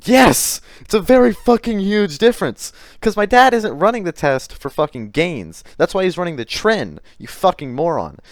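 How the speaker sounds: loud, high, heated rebuttal; real indignation, voice jumping up; exasperated outburst. Real, not acted. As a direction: burst out yelling, correcting a fool. Angrily rebutting the quoted claim.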